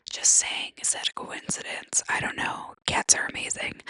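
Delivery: unvoiced secret whisper; conspiratorial whisper. Real, not acted. Conspiratorial aside about coincidence.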